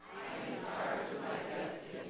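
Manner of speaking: faint congregation repeating from afar; distant group recitation; group echo. Real, not acted. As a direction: murmur it back as a crowd. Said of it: "you can repeat it together".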